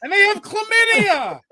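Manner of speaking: slow, laughing reveal about koalas; laughing punchline. Real, not acted. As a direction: let the laugh break the line.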